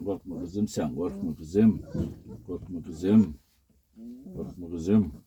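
low soothing voice for a frightened pet; calm her down, low and gentle; reassuring. Real, not acted. Soothing a scared pup.

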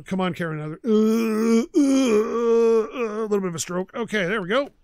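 ordinary delivery

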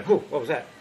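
be the horse caught off guard; startled horse. Pretend. Trainer voices a startled horse reacting to the shiver.

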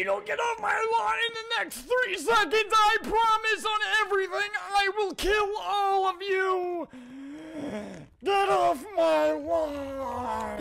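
high, strained yell; mock-furious threat. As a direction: scream the warning at trespassers. Recorded threat to get off lawn.

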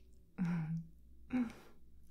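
low, breathy little laugh; teasing chuckle. Acted. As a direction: chuckle as if in on a secret. Teasing the visitor about demons.

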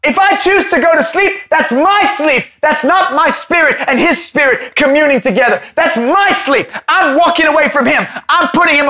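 high, shouted preaching on my sleep; fiery preaching. Acted. Preacher shouting at the congregation about sleep, fiery. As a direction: hammer it home from the pulpit.

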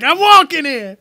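high, indignant Brooklyn holler; Brooklyn accent. Acted. One guy on the street, indignant Brooklyn accent. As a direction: object like a Brooklyn pedestrian. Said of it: "one guy that's like".

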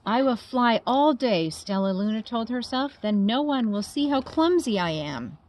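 put-on lively storybook narration for kids; reading to children. A narrator's reading. Biologist reading a bat story to children, animated. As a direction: read it like bedtime story time. Reading a picture book aloud.

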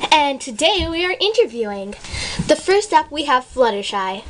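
usual speaking voice

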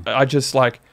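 usual speaking voice

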